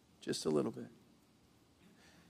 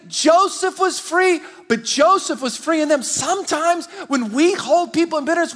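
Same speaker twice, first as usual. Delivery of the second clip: high, loud soaring preacher climax; preaching at full force; 9 semitones higher, 6 dB louder than usual. Acted. Pastor urging his church to set others free, preaching at full force. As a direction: lift the room, pushing every word.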